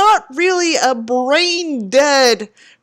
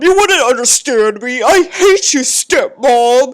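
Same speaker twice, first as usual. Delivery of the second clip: hammed-up teen tantrum, a bit louder; mock bratty teen; 3 dB louder than usual. Mocking the teen raging at her stepmom, hammed-up tantrum. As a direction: sneer it like a sulky kid throwing a fit.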